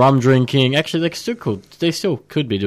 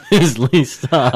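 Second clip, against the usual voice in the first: laughing through the name; 3 dB louder, slower than usual. Real, not acted. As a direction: giggle while saying it. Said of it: "ah, screw up".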